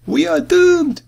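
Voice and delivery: high, loud panicked shout. A narrator's reading. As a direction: scream out the doom at the top of the voice. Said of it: "shouted Matty".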